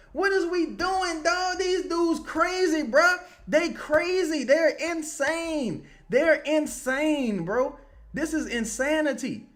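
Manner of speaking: high-pitched, worked-up ranting; exasperated disbelief. Real, not acted. Fan ranting at his team's insane play, exasperated disbelief.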